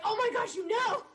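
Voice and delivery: high, gushing retold outburst; re-enacted squeal of excitement; fangirl excitement. Acting out her excited fangirl reply.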